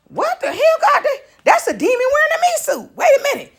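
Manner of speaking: high, startled cry with a laugh; shocked outcry. Retold or imitated. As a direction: react as if you just saw a demon. Shocked seeing a demon.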